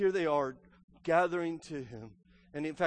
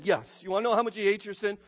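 usual speaking voice

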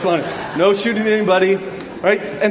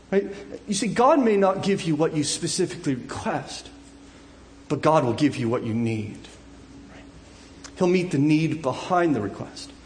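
usual speaking voice

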